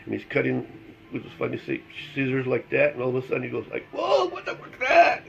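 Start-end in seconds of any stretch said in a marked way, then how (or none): 3.9-5.2 very high mock-horrified outburst; imitated freak-out. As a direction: squeal it like the startled guy. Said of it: "they're freaking out".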